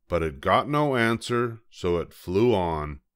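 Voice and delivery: plain reading voice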